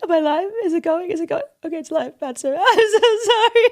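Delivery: very high, hammed-up sobbing and laughing; fake-weeping starlet filming herself, wailing; mock crying celebrity. Mocking a celebrity crying on Instagram, high fake sobs. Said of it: "Think about the steps there".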